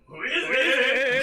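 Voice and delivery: loud call through the door. Acted. Calling out at the door.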